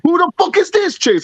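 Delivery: very high, baffled question; retold incredulity. Confused friend asking Chase about the patch, incredulous.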